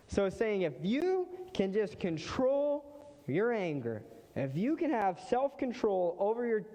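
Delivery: climbing, fired-up sermon voice; rising preaching fervour. Real, not acted. Preaching climax on anger control.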